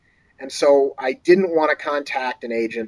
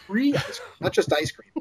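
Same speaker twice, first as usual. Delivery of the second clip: Free raised high, set against plain ice cream; stress on free; 4 semitones higher, 6 dB quieter than usual. Real, not acted. Writer gloating to host about free treat, stress on Free. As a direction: lean on Free. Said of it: "I got free ice cream".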